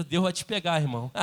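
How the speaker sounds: the preacher has the church tell their neighbor, teasing; playful line to a neighbor. Shown as a demonstration. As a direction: say it to the one beside you with a grin. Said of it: "Fala pra quem tá perto".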